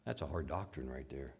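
soft, low grumbling aside; hushed hint of a doubting listener; murmuring listener. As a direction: mutter like an uneasy churchgoer. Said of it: "people go".

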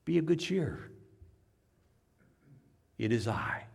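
soft, unhurried reassurance; quiet calm reply. Acted. Jesus to frightened disciples, calm quiet reply. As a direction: answer the panic softly.

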